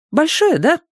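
put-on very high child's voice; little boy. A narrator's reading. Small boy remarking to the watchman, very high and childlike. Маленький мальчик добавляет.

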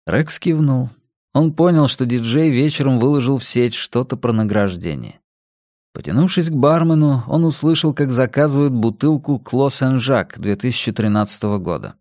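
plain reading voice

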